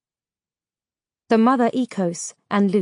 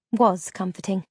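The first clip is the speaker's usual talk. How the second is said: ordinary delivery, nothing marked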